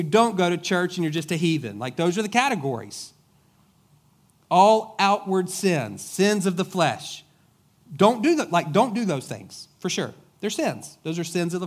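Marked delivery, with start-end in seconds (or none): 4.5-7.2 slow, loud and higher; preacher hammering the point; emphatic. Acted. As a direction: lean hard on each word. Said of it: "emphatic list of youth rules".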